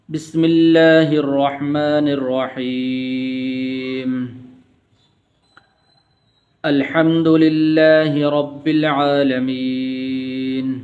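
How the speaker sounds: very slow chanted recitation with long vowels; slow melodic Fatiha recitation; Quran recitation. Acted. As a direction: draw each verse out in tajweed.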